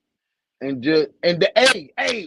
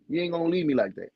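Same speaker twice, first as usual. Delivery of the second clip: usual speaking voice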